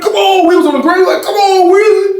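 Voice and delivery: hammed-up high whining plea; mock pleading. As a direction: beg like he's on the floor. Imitating the man pleading on ground.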